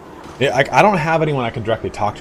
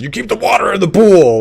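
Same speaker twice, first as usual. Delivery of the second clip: raised, high-pitched imitation of dad yelling; louder mock yell in dad's voice; 10 semitones higher, 6 dB louder than usual. Dad to the kids jumping round the pool, mock yell. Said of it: "my dad would always yell".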